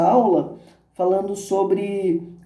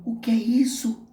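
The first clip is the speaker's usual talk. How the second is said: hushed, high, anxious asking; worried near-whisper; distressed question; 5 semitones higher, 4 dB quieter than usual. A narrator's reading. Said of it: "pergunta aflito".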